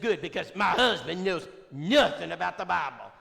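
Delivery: Brenda's voice. Retold or imitated. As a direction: scoff at the husband in her voice. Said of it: "She said".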